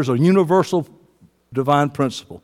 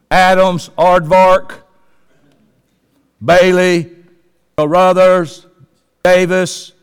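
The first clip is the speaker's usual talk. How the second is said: mock roll call; 2 semitones lower, slower than usual. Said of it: "I'm gonna call your name up here".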